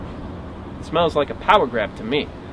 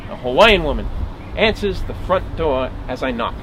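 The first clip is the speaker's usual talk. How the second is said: ordinary delivery, nothing marked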